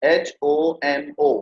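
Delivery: slow letter-by-letter spelling of एच ओ एम ओ; each letter of एच ओ एम ओ said slowly and apart; spelled out slowly. Shown as a demonstration. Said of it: "जैसे होमो sapiens".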